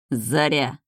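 tight, reluctant single word; forced out with contained irritation; through clenched teeth. A narrator's reading. Groom grudgingly names his bride, teeth clenched. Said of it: "сквозь зубы процедил".

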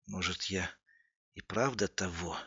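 soft, hesitant confession; quiet self-doubt. A narrator's reading. Andrei asks whether he has gone mad, quiet and doubting. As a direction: drop the voice and wonder uneasily.

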